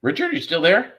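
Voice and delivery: loud, high check-in call. Real, not acted. Host calls out to the dropped guest Richard, loud and high. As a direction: reach out to a lost caller.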